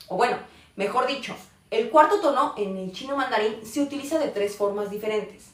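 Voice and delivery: ordinary talk, nothing marked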